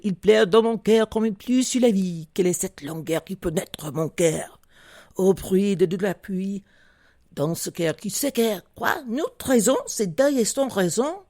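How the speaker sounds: reciting verse in French; French poem in a lilting French accent. Shown as a demonstration. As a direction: recite French poetry as in a speech competition. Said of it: "I had the, uh, the French accent".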